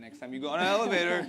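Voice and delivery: high, loud and amused; laughing. Real, not acted. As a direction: build into a loud, laughing tease. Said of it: "I'm laughing".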